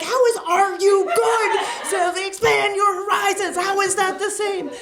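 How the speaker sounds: high, loud, laughing disbelief; laughing outrage at the misheard phrase; incredulous shout. Retold or imitated.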